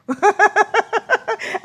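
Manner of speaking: short burst of laughter; bright laugh. Real, not acted. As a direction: laugh out at the joke. Laughs at the soup joke.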